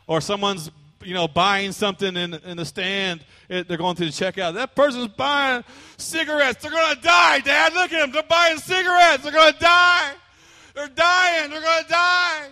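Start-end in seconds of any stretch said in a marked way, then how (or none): 4.5-12.4 put-on high, loud child's voice crying out; alarmed child. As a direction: shout it like a scandalized little kid tugging at dad. Child shouting alarm in checkout.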